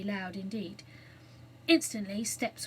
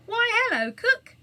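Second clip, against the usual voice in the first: much higher, loud surprised hello; delighted surprise at finding her; surprised greeting; 12 semitones higher, 9 dB louder than usual. A narrator's reading. Anthea finding Cook on the island, surprised greeting.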